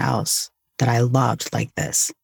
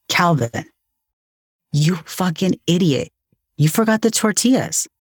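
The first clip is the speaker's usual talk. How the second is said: exasperated self-berating, pitched higher; self-scolding; 5 semitones higher than usual. Retold or imitated. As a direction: retell a mild scolding of yourself, voice rising. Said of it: "I said to myself".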